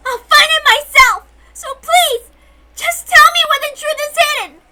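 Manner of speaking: high, loud, crying beg; tearful shouting plea. Acted. Girl begs the hidden voice for the truth, crying and shouting. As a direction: plead through tears at full voice.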